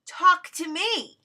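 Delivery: taunting Jeff into an exchange; baiting. Retold or imitated. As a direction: tease him into replying. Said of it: "That's me baiting Jeff, saying".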